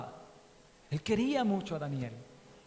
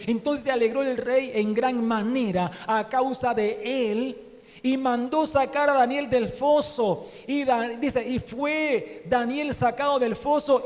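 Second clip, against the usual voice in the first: loud fervent sermon delivery; full-force preaching; 4 semitones higher, 5 dB louder, slower than usual. Acted. Preacher exhorting the congregation, loud and fervent.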